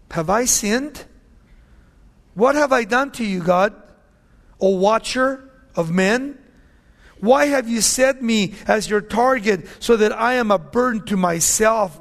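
preacher's loud lament; anguished scripture cry. Acted. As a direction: burst out pleading to God. Reads Job's questions.

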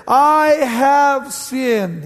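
loud, very slow, drawn-out confession; Saul's confession. Acted. Loud, very slow, drawn-out confession. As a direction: Saul drags out his hollow confession to David.

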